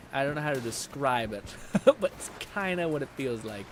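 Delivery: ordinary talk, nothing marked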